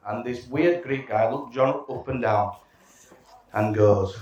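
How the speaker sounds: ordinary delivery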